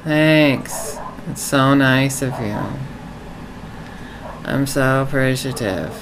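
low, deadpan gratitude; low-pitched, hollow appreciation; insincere thanks. Shown as a demonstration. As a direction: thank them with pointedly unmoved intonation. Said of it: "Do you like the way I said that?".

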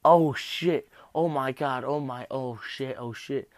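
imitating an injured skater, high and loud at first; mock alarmed skater cry, easing off. Retelling skaters in injury videos reacting to getting hurt, mock alarm. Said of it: "they would be like".

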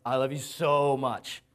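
"I love you so much" said slowly, leaning hard on "so"; emphatic so. Shown as a demonstration. Preacher to congregation, showing the wrong reading with an emphatic "so". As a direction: stretch out "so" in "I love you so much" like a gushing declaration. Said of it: "it's not an emphatic, like, so".

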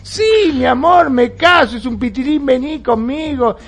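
high, slow and elated; overjoyed groom. Retold or imitated. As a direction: gush with relief and joy. Hombre enamorado celebra. (novio feliz, agudo y lento)

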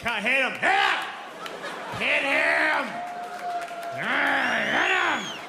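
loud, rising shouts; egging on. Pretend. Crowd urging to hit.

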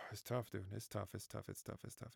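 sad, trailing off to almost nothing; grieving repetition fading into silence; heavy-hearted fading. Real, not acted. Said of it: "it does hurt my heart".

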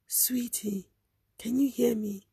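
soft whisper near the ear; hushed urgent whisper. Retold or imitated. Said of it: "She was whispering now".

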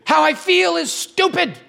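high, slow, whiny imitation of his wife; mock his wife's upset whine; hammed-up high wife voice.

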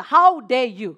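loud, indignant challenge; retold anger. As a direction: hit it hard in mock outrage. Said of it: "Because I was so angry".

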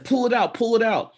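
high, loud urgent cry; hammed-up pain and panic; mock panicked plea. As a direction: beg urgently, imitating an injured man. Retells injured man's urgent plea.

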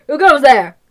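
guard's challenge. Acted. Guard poking his head in on an intruder, loud challenge. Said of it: "a guard poke his head in and say".

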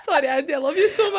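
high, giggly apology; affectionate words squeezed out between laughs; laughing apology. Real, not acted. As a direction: apologise through a fit of laughter. Apologising to friend while laughing.

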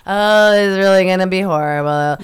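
loud, hammed-up moaning complaint; exaggerated woe; mock dread. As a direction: groan it out like a dejected hiker. Acting out the negative hiker.

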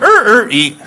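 high laughing er er eat of a car over a mattress; mock strain noise. Comic acting out parking on the mattress with a silly strain noise. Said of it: "I just went".

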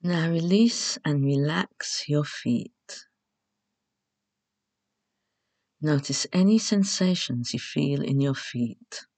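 measured relaxation instructions with long pauses; guided meditation. Acted.

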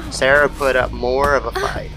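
normal talking voice

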